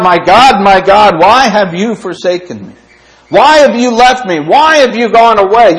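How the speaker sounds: loud, high anguished cry to God. Retold or imitated. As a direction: call out the psalm like a forsaken soul. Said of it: "We cry out".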